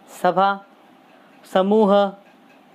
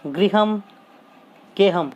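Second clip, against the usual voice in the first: ordinary talk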